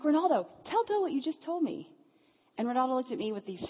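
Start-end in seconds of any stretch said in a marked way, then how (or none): 0.0-1.8 higher, louder eager urging; excited call. Retold or imitated. Urging the orphan to repeat his dream, loud and eager. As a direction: push him to speak up, loudly. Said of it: "I'm like".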